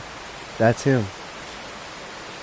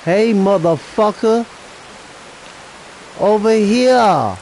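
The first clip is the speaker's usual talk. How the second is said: bait the beast from afar; taunting call; 7 semitones higher than usual. Real, not acted. Calling a creature to lure it.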